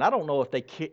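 ordinary talk, nothing marked

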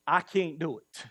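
ordinary talk

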